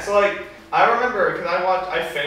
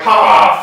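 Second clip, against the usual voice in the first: loud whispered villain cackle; evil laugh; 4 semitones higher, 13 dB louder than usual. Retold or imitated. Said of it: "his inner monologue".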